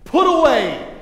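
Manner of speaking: high, loud proclamation; loud scripture reading. Retold or imitated. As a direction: preach the verse out. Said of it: "Verse thirty-one, look at this".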